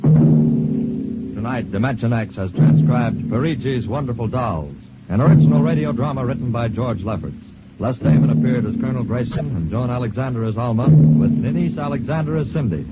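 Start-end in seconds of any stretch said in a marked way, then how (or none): none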